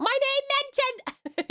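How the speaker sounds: very high, drawn-out squeal; excited squeal. Acted. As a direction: squeal it slowly, like a thrilled fan. Squeals out a viewer's chat message.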